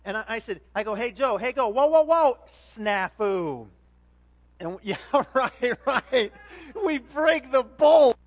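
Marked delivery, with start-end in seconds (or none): 0.9-3.7 loud retold yell of alarm, slowing on the last whoa; alarmed shouting, then a slow stretched whoa; warning shout. Calling a warning to Joe. 6.9-8.2 dismay. Retold or imitated. Bolt snaps in the story.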